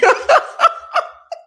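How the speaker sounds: high breathless cackle; real high-pitched laughing fit. Real, not acted. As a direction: burst into a high wheezing laugh. Laughs at comment.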